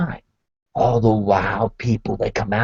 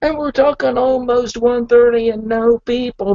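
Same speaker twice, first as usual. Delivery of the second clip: normal talking voice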